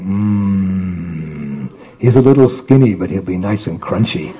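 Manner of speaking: low, quiet, relishing tiger voice; a lightly voiced tiger sizing up a snack; hungry tiger musing. Tiger eyeing the skinny yogi.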